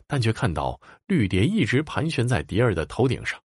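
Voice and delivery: plain reading voice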